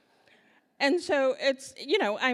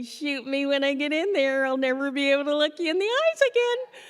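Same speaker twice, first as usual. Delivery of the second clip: loud mortified outburst; loud hammed-up despair; mortified plea; 6 semitones higher, 7 dB louder than usual. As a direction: beg in total embarrassment. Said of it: "I just felt like going".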